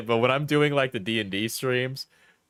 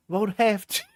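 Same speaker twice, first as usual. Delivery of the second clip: higher-pitched mock British accent; put-on accent; 5 semitones higher than usual. Reading breakup texts back, high put-on British accent. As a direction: act the texts out in exaggerated British. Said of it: "when I'm doing the accent".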